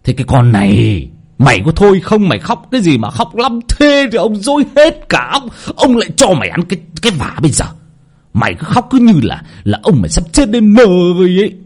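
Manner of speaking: high-pitched, irritated tirade; angry bellow. A narrator's reading. Master yelling at the crying maidservant, angry and high. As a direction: lose patience and yell, stretching the last words. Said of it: "Ông quát".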